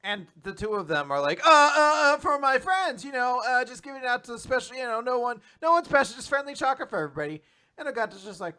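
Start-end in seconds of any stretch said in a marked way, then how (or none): 1.4-7.4 flustered denial. Retold or imitated. Girls deny crush, flustered.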